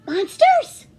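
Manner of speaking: plain reading voice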